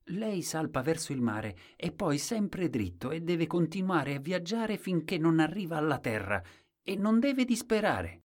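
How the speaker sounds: high-pitched earnest directions; high storybook simpleton voice; Colombin. A narrator's reading. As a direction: explain the route high up, rising toward the end. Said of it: "disse Colombin".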